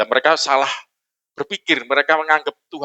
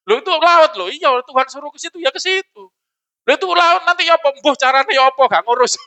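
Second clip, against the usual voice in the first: panicked complaint; 8 semitones higher than usual. Retold or imitated. Mocking panicked Israelites.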